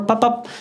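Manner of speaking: high voiced knock noise; vocal door knock; knock sound. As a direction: mimic a knock with your mouth.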